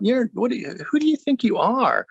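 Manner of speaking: high, sneering taunt of the enemy's voice; mocking taunt. The enemy's voices taunting Mike as he rises, high mocking jeer. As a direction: jeer at him from above. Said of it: "just taunting me".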